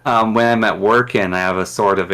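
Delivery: usual speaking voice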